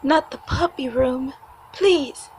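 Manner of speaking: high, loud, begging cry; pleading. Acted. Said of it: "they'll cry, they'll beg, they'll plead".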